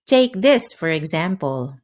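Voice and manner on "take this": ordinary talk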